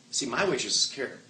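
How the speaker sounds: usual speaking voice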